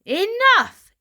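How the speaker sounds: high loud angry yell; father cutting off his son. Acted. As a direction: snap it shut like an angry father. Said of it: "yelled Father".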